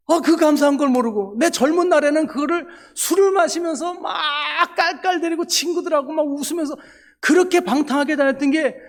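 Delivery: high, loud, voice breaking with tears; tearful joy. Real, not acted. Preacher confessing wasted youthful days, high tearful cry.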